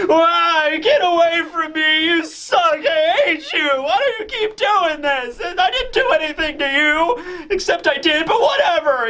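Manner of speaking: hammed-up high sobbing whine, half shouted; drunken crying bawl. The dragon Kaido bawling at Luffy, mock drunken sobbing. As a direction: wail it out like a drunk giant who can't stop crying. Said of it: "he just starts crying and bawling".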